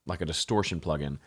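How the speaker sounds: usual speaking voice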